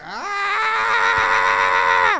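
low loud joyful shout; a burst of delighted yelling; excited yell. Retold or imitated. Dad lighting up when Trump comes on, low loud yell.

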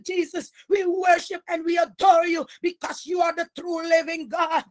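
normal talking voice

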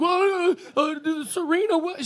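high, stammering mock protest; mock flustered guy. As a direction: sputter it like a guy caught with no comeback. Mocking a man grasping for argument.

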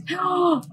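quick high gasp; sharp gasp of surprise. Real, not acted. Player spotting the rescue light, a sharp surprised gasp. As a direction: catch your breath at a sudden find.

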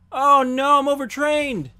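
high, loud mock wail; hammed-up despair. Real, not acted. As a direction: overplay the disaster. Dismay at overtraining.

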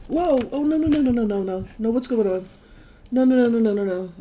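high panicked stream of no; rapid alarmed no no no. Real, not acted. Boat attacked, panicked nos.

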